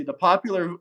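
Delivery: ordinary delivery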